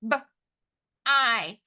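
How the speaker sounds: short B, pause, long I; sounded out. Shown as a demonstration. Teacher tapping the first syllable, sounded out. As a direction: break b-I into single sounds. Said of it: "First syllable".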